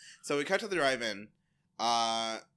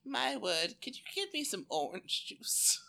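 imitating a fussy patient; 11 semitones higher than usual. Acting out a posh patient.

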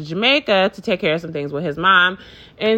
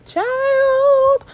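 high exclamation. Real, not acted. Telling viewers about The Circle, squealing high.

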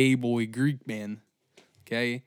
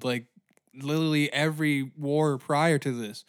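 ordinary talk

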